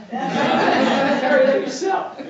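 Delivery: laughing, high and loud, slowly drawn out; teasing friends. Retold or imitated. Jersey friends ribbing him about his record, laughing. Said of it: "My friends back east will go".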